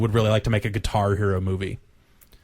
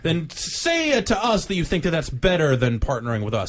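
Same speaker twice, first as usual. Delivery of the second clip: voicing Tecmo as a snooty rep; mock company spokesman; 7 semitones higher than usual. Mocking Tecmo's reply to Square.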